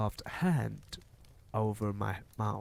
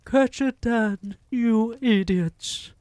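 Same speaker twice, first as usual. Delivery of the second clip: very high, very loud shriek of rage; furious scream; 12 semitones higher, 10 dB louder than usual. A narrator's reading. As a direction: shriek at your useless minions. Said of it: "screamed the Grand High Witch".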